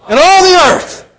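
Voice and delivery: loud, high triumphant proclamation; exultant preacher crying out scripture; proclaimed psalm. Acted. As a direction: ring it out like a psalm of praise.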